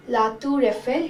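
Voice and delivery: La Tour Eiffel said lah toor eh-FEL; La Tour Eiffel with a rolled French r. Shown as a demonstration. Teacher modelling the French name of the tower. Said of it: "In French we say it as".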